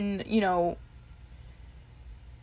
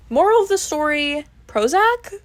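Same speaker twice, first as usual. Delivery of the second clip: ironic punchline; 8 semitones higher, 5 dB louder than usual. Real, not acted.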